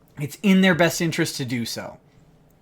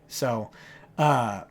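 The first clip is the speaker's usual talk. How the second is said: normal talking voice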